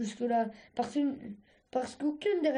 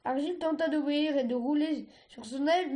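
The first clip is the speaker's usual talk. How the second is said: plain reading voice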